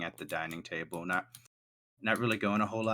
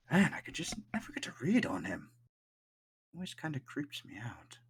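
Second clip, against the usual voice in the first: hushed muttering, fading into a whisper; mumbling to himself; 7 semitones higher than usual. Acted. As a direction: mumble privately under your breath. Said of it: "hear Brooke kinda mumbling to himself".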